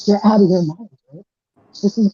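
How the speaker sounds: muffled, far-off voice; sounding far away, muffled. Real, not acted. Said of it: "you sound really far away".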